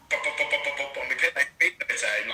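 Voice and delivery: usual speaking voice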